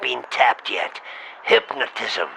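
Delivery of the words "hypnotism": normal talking voice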